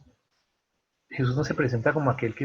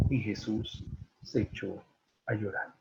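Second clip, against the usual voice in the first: hushed solemn reading; 3 semitones lower, 10 dB quieter than usual. Acted. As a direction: let the verse sink almost to silence. Solemn reading of Jesus weeping. (lectura solemne, muy bajito)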